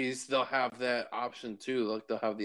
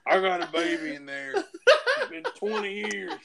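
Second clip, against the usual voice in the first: high, laughing joke voice, climbing higher; mock expectant mother; 6 semitones higher than usual. Joking as Judith's mother still pregnant, in a high laughing put-on voice.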